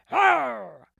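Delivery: loud growled roar; cry of rage. Acted. The thief lunges after Nancy, furious roar. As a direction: roar with fury. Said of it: "The thief gave a cry of rage".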